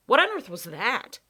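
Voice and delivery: jolted by the strange noise; startled. Acted. Startled question at eerie sound.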